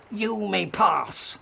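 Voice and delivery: grudging troll, drawn-out words. A narrator's reading. Troll lets the youngest goat go, slow troll voice. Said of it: "said the troll".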